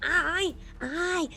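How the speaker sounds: marionnette aiguë et rapide qui chuchote fort; voix de marionnette. Acted. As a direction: petite voix de marionnette pressée. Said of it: "j'ai une voix de marionnette". (voix de marionnette)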